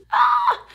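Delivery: short sharp yelp of a scream; startled high scream; sudden scream. Real, not acted. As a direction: let out a sudden loud shriek. Sudden scream mid-intro.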